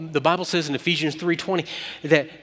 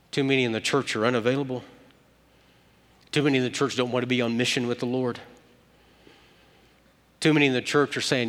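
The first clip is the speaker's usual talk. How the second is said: brisk rebuke; faster than usual. Real, not acted. Rhetorical charge in sermon.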